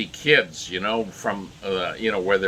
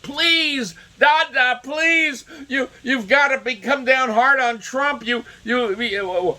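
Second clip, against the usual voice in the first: mock spoiled kid begging Dad, very high-pitched; whiny pleading rich kids; 13 semitones higher, slower than usual. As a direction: plead shrilly high, like a pestering child. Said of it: "they were probably hectoring poor Rupert".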